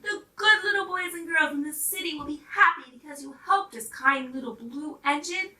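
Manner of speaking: loud, joyful thanks; grateful toys. Acted. Toys thanking the blue engine, loud and joyful. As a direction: thank her with delight.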